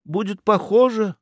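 high-pitched, unsure protest; flustered. A narrator's reading. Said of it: "растерянно пробормотал Федяшев". (растерянно, высоким голосом)

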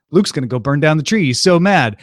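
ordinary talk, nothing marked

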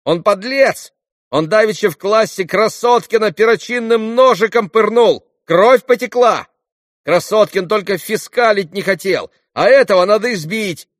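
put-on high, loud accusing kid; irritated child's voice. A narrator's reading. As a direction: shout accusations like an angry boy. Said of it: "раздражённым детским голоском". (раздражённые детские крики)

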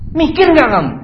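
high, loud accusing shout; acted furious father; angry scolding. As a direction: berate the babysitter.

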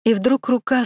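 ordinary delivery, nothing marked

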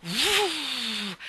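drawn-out whoosh rising and falling; howling wind whoosh. A narrator's reading. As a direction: imitate a wild gust of wind. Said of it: "con un grido selvaggio".

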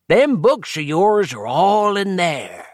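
put-on high, folksy old codger drawl; old man voice. A narrator's reading. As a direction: play a rambling old timer.

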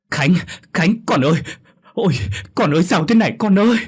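very high, loud, fast howl of grief; father's anguished wail. A narrator's reading. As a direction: cry out over his son's body. Father finds dying son. (người cha gào khóc thảm thiết bên con)

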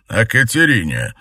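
high, loud, spiteful; angry. A narrator's reading. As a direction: spit the name out. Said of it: "злобно ответил он".

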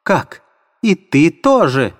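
high-pitched indignant outcry; shrill, shocked accusation; Katerina shrieking. Acted. Katerina turning on the landlady, high shrill outcry.

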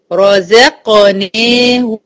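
"رزقناهم" chanted slowly as "ro-ze-qo-nehum"; heavy, light, heavy. Shown as a demonstration. Teacher models correct reading, "رزقناهم" slow, alternating heavy and light. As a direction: give every letter its vowel in "رزقناهم". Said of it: "أبين إن دي مفخمة مرققة مفخمة".

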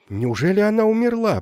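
alarmed. A narrator's reading. Fearing she is dead.

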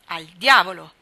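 a sudden loud curse; cursing outburst. A narrator's reading. Dan cutting in at the dinner table, loud curse. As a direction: blurt the oath out hard. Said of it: "vizio di imprecare".

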